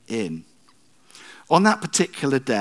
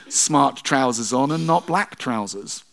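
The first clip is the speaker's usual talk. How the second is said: ordinary talk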